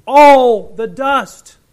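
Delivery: loud, high proclaiming of the scripture; preacher thundering out the plague of gnats; full-force preaching. Acted. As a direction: preach it to the back row.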